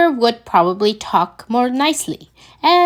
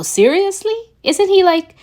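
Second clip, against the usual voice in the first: much higher, louder disbelief; incredulous; 9 semitones higher, 3 dB louder than usual. A narrator's reading. Peter to Friday about Tony's best friend, incredulous and high. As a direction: squeak it out in disbelief.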